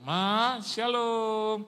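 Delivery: high, called-out hello; acted arrival greeting. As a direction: hail mother from the doorway. Calls greeting to mother.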